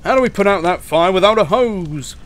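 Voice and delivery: irate character voice; demanding. Acted. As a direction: demand it indignantly at volume. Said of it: "demanded the fire engine".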